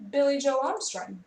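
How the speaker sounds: ordinary talk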